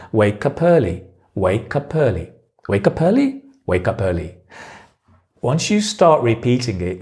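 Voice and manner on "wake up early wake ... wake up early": "Wake up early" run together as way-kuh-pearly, repeated; connected speech. Shown as a demonstration. Teacher drilling the sound for learners, "Wake up early" fully linked. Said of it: "you hear the sound".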